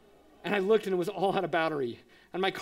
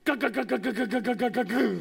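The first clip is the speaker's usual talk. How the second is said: higher, rapid-fire stuttering engine imitation; car breakdown sound effect; sputtering car noise; 4 semitones higher, 3 dB louder than usual. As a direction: chug like a dying engine, drawing out the last one. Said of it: "our car goes".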